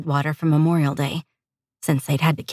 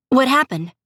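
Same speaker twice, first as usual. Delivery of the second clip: higher-pitched alarmed question; fearful; 7 semitones higher, 3 dB louder than usual. A narrator's reading. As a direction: ask with a touch of sudden fear. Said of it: "she heard the fear in her friend's voice".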